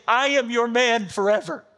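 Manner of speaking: impassioned vow of surrender; fervent vow. Retold or imitated. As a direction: proclaim it as a heartfelt oath. Congregation's vow of loyalty.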